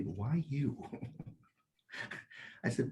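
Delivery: usual speaking voice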